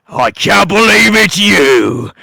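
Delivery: drawn-out villain delight at seeing him; a gloating villain; gleeful villain greeting. Acted. Villain Roland greets Cyrus.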